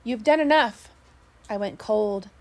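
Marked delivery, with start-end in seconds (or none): 0.0-0.9 higher, louder shouted order; yelled. A narrator's reading. Mate orders the winner off, higher louder yell. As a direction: shout him off the beaten man. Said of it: "yelled the mate at me".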